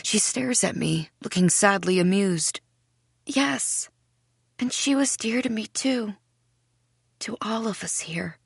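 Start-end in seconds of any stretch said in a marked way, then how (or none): 3.3-8.4 higher, soft voice, fading to near whisper; a strange girl's gentle, fond reply; wistful tenderness. A narrator's reading. Sadly amused girl answers tenderly.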